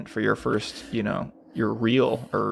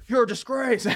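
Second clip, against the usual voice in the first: outraged; 12 semitones higher than usual. Pretend. Playing outraged actor.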